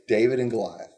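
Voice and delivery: ordinary talk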